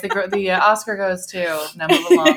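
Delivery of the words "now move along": high, loud hammed-up gatekeeper order; jokey officious brush-off; mock guard. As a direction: shoo them off like a smug guard. Mocking the rental car guard.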